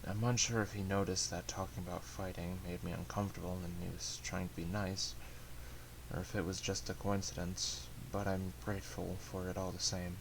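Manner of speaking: soft, subdued first-person narration; hushed, even storytelling winding down toward sleep; story narration. A narrator's reading. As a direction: read it as a quiet inner monologue.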